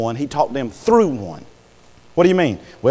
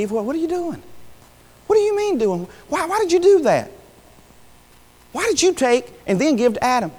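pitched way up, disbelieving questions; a voice shooting high in disbelief; high incredulous questioning; 12 semitones higher than usual. Retold or imitated. God questioning Eve, high and incredulous.

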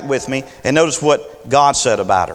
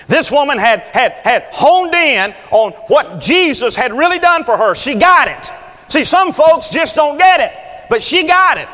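preaching at full force; 8 semitones higher than usual. Acted. Preacher building toward a shout to the congregation. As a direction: drive it home like a revival preacher.

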